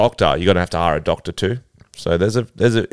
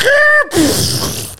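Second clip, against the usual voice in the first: high squeal crash noise; 3 dB louder than usual. Pretend. Crash sound effect.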